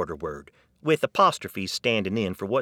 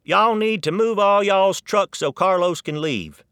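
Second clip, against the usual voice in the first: loud, high Texan shout across the yard; Texan accent, calling out; 9 semitones higher, 5 dB louder than usual. Retold or imitated. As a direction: call it out Texas-style at a barbecue. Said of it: "I heard this sentence at a barbecue".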